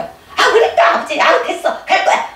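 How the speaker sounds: a fed-up son cutting the visit short; lightly voiced annoyed brother; irritated younger sibling. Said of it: "동생 경을 보면 십 분을 못 넘겨".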